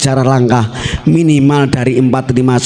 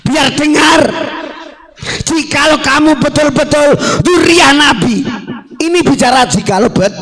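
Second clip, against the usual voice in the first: high, forceful rally oratory; impassioned sermon pitched high; fiery preaching; 11 semitones higher than usual. Real, not acted. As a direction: ring it out to the crowd at full force. Preaches at full force to crowd.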